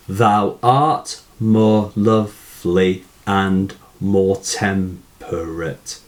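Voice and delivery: slow, even syllable count; measured syllable-counting demo. Shown as a demonstration. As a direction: recite it slowly, checking off each syllable. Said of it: "So let's just check".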